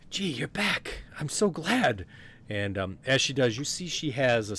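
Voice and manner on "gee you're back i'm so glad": high-pitched, excited welcome; delighted greeting. Acted. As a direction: greet a dear friend with a loud rush of joy. Delighted greeting of returning friend.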